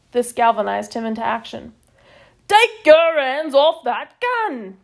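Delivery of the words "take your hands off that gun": slow, louder sergeant-major bellow. A narrator's reading. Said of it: "he bellowed".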